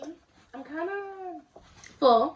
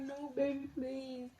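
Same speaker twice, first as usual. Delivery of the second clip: much softer, pleading hush; begging softly; 4 semitones higher, 16 dB quieter than usual. Retold or imitated. Boyfriend begs girlfriend not to call police, soft pleading. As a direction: beg her quietly not to call. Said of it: "He's like".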